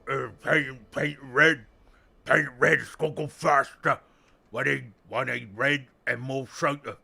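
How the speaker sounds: loud, rough ork boss shouting for soda and red paint; hammed-up ork grunts, bellowed at the boys; rowdy ork voice. Voices orks wanting soda and red.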